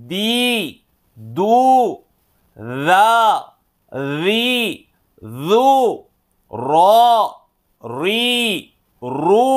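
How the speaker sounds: short syllables one by one, each rising at the end; recitation drill. Shown as a demonstration. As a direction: read each out for the class to echo. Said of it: "మన యొక్క voice raise అవుతుంది". (ఒక్కొక్క అక్షరం పైకి ఎత్తి చదవడం)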